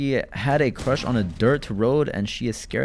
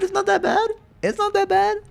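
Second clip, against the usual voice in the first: very high squeak; squeaky voice. Pretend. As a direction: hit "It's not that bad" in falsetto. Higher squeaky repeat.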